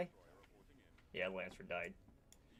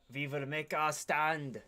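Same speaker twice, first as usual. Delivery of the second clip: slow, loud and high battle cheer; rally the troops with a drawn-out cheer; rallying cry; 5 semitones higher, 5 dB louder, slower than usual. Acted. Commander cheering on his fleet, slow loud rallying cry.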